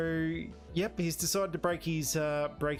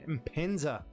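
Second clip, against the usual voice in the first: normal talking voice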